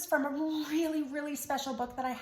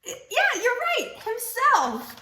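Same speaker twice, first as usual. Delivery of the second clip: excited reveal; 10 semitones higher, 5 dB louder, much slower than usual. Acted. Excited answer to kids.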